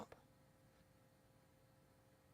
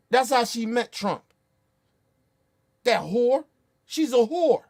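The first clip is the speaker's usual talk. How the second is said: real anger, louder and higher, building; angry rant; 4 semitones higher, 4 dB louder than usual. Real, not acted. Ranting at Trump supporters about Melania, loud and furious. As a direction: hammer it home, fed up and shouting.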